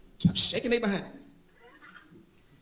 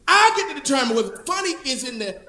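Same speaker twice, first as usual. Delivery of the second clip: normal talking voice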